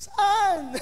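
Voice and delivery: high, laughing "saan"; laughing question. Retold or imitated. Pastor retelling his reply to the old man, laughing. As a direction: ask through a laugh. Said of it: "retold dialogue, laughing".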